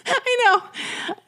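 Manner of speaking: high, laughing voice; laughing agreement. Real, not acted. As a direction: agree through real laughter. Cracking up at the co-host's quote.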